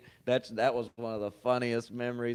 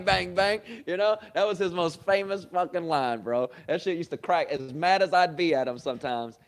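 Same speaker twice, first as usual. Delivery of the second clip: ordinary talk, nothing marked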